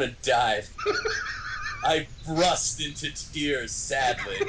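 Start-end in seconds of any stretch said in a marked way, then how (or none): none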